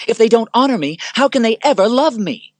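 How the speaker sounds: very high, loud wail, honor stressed hardest; anguished shout. Acted. Commodus crying out to Lucilla, very high and loud. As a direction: scream the need to be loved. Rising rage.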